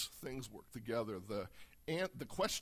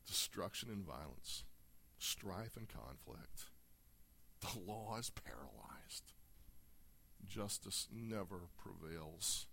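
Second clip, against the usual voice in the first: hushed lament; 5 semitones lower, 6 dB quieter than usual. Acted. Quiet restating of complaint.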